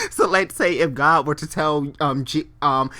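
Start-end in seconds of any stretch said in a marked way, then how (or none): none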